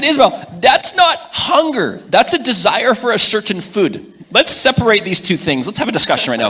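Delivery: father firmly correcting his daughter; firm parent voice. Retold or imitated. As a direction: lay down the law to the child. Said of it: "I'm not kidding about that".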